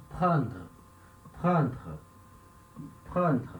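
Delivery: 'prendre' with both Rs in the throat; careful 'prendre', throat R after P and after D; two French Rs. Shown as a demonstration. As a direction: pronounce both guttural Rs in 'prendre', spaced repeats. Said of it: "São dois R's".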